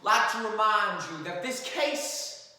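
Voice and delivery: measured, earnest closing argument; courtroom lawyer. Acted. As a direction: address the jury with gravity.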